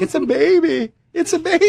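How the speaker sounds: high mock sob; tearfully wailing about the ghost baby, hammed up; mock crying. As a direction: wail it like a blubbering parent. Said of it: "crying, like".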